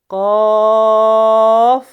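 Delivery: very slow loud drawn chanting of the letters; model recitation. Shown as a demonstration. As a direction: stretch every مد long and full. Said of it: "قاف کو منہ بھر".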